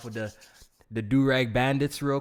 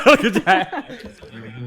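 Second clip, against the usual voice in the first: laughing; 11 semitones higher, 11 dB louder, much slower than usual. Real, not acted. Laughing at clip request.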